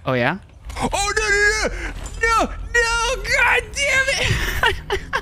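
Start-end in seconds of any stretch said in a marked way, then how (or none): 0.8-3.2 high, frantic cries of no; squeaky panicked protest; panicked no's. Real, not acted. Zip line fail, high panicked cries. 3.2-5.2 high, very slow, laughing through it; half-laughing, half-crying groan; laugh-crying curse. Real, not acted.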